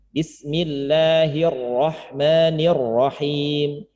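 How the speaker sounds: "bismillahirrahmanirrahim" chanted slowly, doubled letters held; held double letters. Shown as a demonstration. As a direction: hold each tasydid while reciting. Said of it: "Tahan, tahanan itu". (tasydid ditahan)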